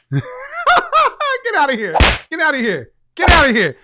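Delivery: loud laughing get outta here; loud real laughing disbelief. Real, not acted. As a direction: shout it through laughter.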